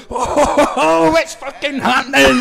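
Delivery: high, loud, drawn-out holler; hyped-up shout, stretched slow; whooping entrance. Retold or imitated. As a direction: whoop it out like a show-off hitting the stage. Acts out his whooping dance entrance.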